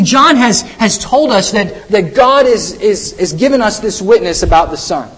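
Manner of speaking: usual speaking voice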